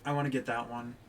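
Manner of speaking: ordinary talk, nothing marked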